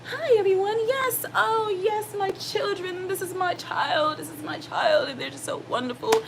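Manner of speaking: very high, put-on sweet public voice; perfect-in-public mom. Mom showing off her children to other people, mock-perfect high voice. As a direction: gush in a high, fake show-off voice. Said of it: "perfect in public for other people".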